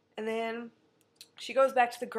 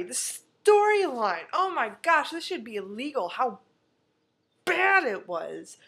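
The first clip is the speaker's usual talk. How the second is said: outraged rant; 2 semitones higher, 6 dB louder than usual. Real, not acted. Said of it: "outraged about the plot".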